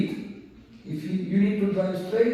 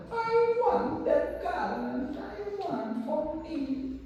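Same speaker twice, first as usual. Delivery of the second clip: very high, drawn-out mock female voice; demanding girlfriend; 12 semitones higher, 5 dB quieter, much slower than usual. Girlfriend demands the garland from her rich businessman, mock high-pitched demand. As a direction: whine it slowly in an exaggerated falsetto. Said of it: "this girlfriend said".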